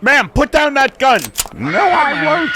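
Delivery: high, loud urgent order; urgent shout. Acted. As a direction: shout at her to drop it. Standoff with gun.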